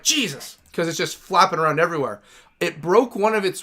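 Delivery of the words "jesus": high-pitched startled exclamation. Retold or imitated. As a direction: blurt it out, caught off guard. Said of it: "it caught me off guard".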